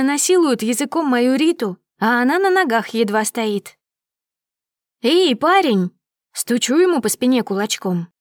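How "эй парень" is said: loud call for attention. A narrator's reading. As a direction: shout to get his attention. Calling out to the man.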